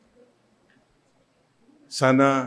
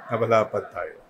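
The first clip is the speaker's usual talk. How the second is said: ordinary talk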